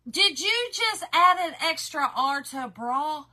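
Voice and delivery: loud, slow, disbelieving question; mock-incredulous. Real, not acted. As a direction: call it out with exaggerated disbelief. Mock outrage at friend's accent.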